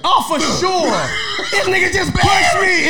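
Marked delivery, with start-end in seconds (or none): 1.5-2.9 high, loud laughing protest; playful indignant shout; mock outraged shout. Real, not acted. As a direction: yell it as if shoved. Mock outrage at friend's joke.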